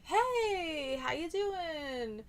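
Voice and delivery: high, drawn-out hello to a friend; friendly greeting. Shown as a demonstration. Showing how they greet a friend, high drawn-out friendly call. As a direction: call out a cheery greeting, stretching the end. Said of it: "if I see a friend, then I would say".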